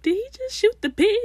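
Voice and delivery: very high retold gasp-question; shocked disbelief. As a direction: recall the horror.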